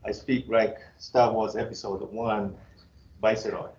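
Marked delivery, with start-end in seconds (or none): none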